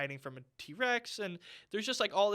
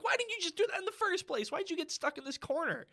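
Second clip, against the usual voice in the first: much higher, incredulous questioning; exasperated; 9 semitones higher than usual. Retold or imitated. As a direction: throw up your hands at the screen. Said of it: "I'm like".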